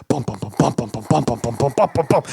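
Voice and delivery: low, rhythmic bum-bum imitation; drum beat. Storyteller imitates the shark documentary soundtrack, drum beat. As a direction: voice a pulsing documentary drum, rising slightly. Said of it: "this drum beat".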